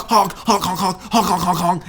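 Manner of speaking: high, loud horn honks; imitated bike horn. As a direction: honk like a clown horn. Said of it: "if you're just honk".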